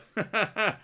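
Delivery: loud, high mock laugh; imitated laughter. Streamer mimicking easily amused partner, exaggerated laugh. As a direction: mimic someone laughing at anything.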